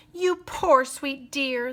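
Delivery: high, slow, cooing sympathy; gushing. Acted. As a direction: gush over the poor thing. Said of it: "the ladies gushed".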